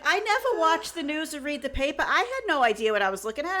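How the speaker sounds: high-pitched, hammed-up Boston accent. Sister-in-law confessing her ignorance of art, high mock Boston accent. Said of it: "she sounds like she's from Boston".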